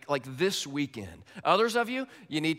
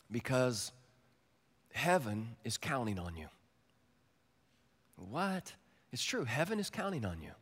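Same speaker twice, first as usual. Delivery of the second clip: soft encouragement; 4 dB quieter than usual. Real, not acted.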